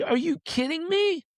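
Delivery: high-pitched indignant disbelief; retold outrage. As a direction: protest way up high, exasperated. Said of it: "And I got mad".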